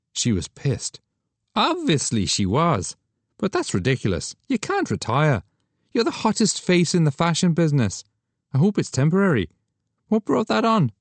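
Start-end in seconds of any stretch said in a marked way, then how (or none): 1.6-10.9 Douglas protesting. Acted. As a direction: argue she can't quit.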